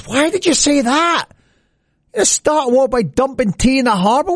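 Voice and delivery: a pal who can't believe it; mock-baffled friend, pitched well up; incredulous mate. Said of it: "his mate says".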